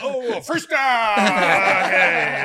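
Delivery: high, loud, slow laughing recovery; sheepish cover-up. Retold or imitated. Referee caught on a live mic, loud laughing cover.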